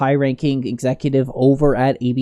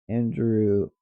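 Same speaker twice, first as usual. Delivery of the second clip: soft, lower, drawn-out words while typing; absent search muttering; 5 semitones lower, 6 dB quieter than usual. Real, not acted. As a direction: say it to yourself as you type.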